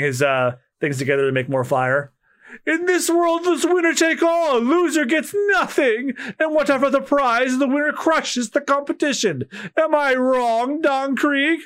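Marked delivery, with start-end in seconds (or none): 2.7-11.6 higher, smug taunting pirate voice; a higher, sneering pirate lording it over the losers; cocky pirate boast. Acted. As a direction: gloat down at the beaten cooks.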